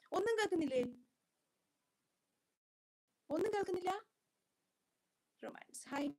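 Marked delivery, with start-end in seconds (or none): 0.1-3.9 high, loud incredulous question; dismayed query. Real, not acted. Streamer asking viewers if they hear nothing, loud and dismayed. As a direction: raise your voice in real dismay.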